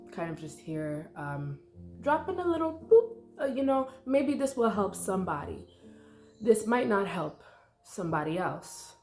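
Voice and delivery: usual speaking voice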